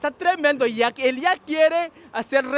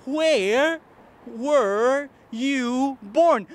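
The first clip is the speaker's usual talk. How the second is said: where were you born, stretched very slowly; teacher modelling each word slowly; slow model phrase; much slower than usual. Shown as a demonstration. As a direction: spell it out slowly for the learner. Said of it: "Repítelo conmigo".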